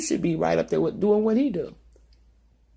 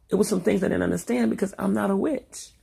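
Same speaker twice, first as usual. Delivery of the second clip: ordinary talk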